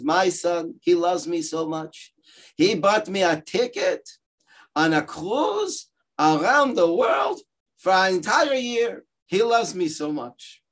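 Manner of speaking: high, sing-song bragging mother; bragging Yiddish mama. Retold or imitated. Yiddish mother bragging about her son's gifts. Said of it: "you know, Yiddish mamas".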